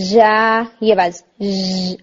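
جا said with a buzzing zh sound, ژ hissed; mispronounced ya with hiss and buzz; wrong buzzy ya. Shown as a demonstration. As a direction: demonstrate the faulty buzzing ya with air. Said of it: "اس میں ہمس آ رہی ہوتی ہے".

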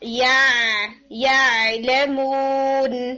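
या drawn out slowly, ain held steady without shaking; held ain demo. Shown as a demonstration. Tajweed teacher modelling a held ain for students. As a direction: hold the ain of या लेमून firmly, very slowly. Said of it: "जैसे word आता है".

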